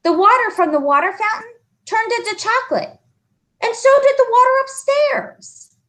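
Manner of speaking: high, earnest schoolboy voice; anxious boy explaining. Acted. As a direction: try hard to make her believe. John complaining.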